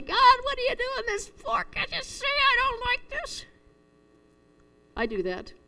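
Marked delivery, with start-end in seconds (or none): 0.0-3.5 high, drawn-out wail of complaint to God; mock scream. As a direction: imitate screaming up at God in protest, slow and high-pitched. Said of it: "I scream to God and say".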